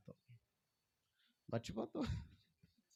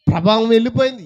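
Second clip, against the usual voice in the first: వెళ్ళిపోయింది repeated much louder and higher; emphatic repeat; 5 semitones higher, 18 dB louder than usual. Shown as a demonstration. Jesus insisting power left him, loud emphatic repeat. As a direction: hammer the repeat home.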